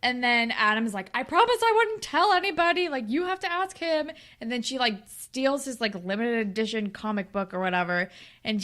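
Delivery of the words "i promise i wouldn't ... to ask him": higher, louder mock protest; pleading Adam. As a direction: insist you kept the secret.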